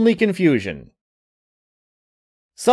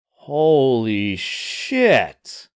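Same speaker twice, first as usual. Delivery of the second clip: very slow, drawn-out low amazement; stunned mutter; 5 semitones lower, 3 dB quieter, much slower than usual. A narrator's reading. Ryan recognizes the woman, stunned slow mutter. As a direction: stretch it out under your breath, astonished. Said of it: "muttered Ryan".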